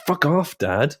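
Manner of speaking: mock teenage son in a light American accent; a touch of an American accent. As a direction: snap it like a sulky American teen. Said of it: "weird American accent".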